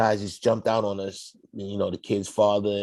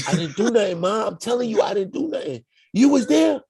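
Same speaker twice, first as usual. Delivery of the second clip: high pleading son; 12 semitones higher than usual. Retold or imitated.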